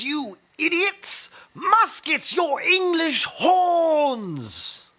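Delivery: high, slowing shout at his men; exasperated shout. Acted. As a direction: berate the idiots who don't get it. Berating confused troops.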